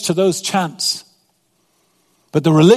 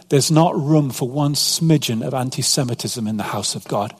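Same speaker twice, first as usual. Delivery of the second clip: usual speaking voice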